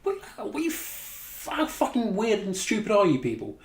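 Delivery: high, slowed, breathy start in disbelief, then a fast rant; can't believe what he's seeing; baffled squeak rising into disgust; incredulous outburst. Real, not acted. Reacting to the fist bump scene.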